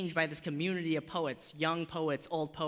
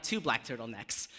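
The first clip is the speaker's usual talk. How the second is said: normal talking voice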